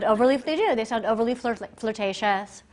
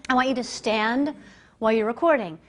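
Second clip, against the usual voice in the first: ordinary talk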